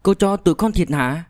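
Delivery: put-on high-pitched, hesitant girl; a young girl asking with a touch of trembling; tremulous question. A narrator's reading. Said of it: "run run hỏi". (giọng bé gái cao, hơi run run hỏi)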